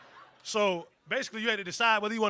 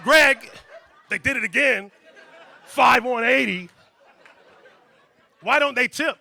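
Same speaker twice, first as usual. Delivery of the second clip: complaining waitress; 3 semitones higher, 10 dB louder than usual. Pretend.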